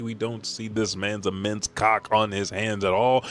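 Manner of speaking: ordinary talk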